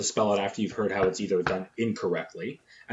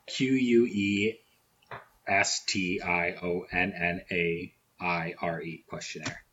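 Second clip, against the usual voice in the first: slow spelling out; 4 semitones lower, 5 dB quieter, slower than usual. Real, not acted.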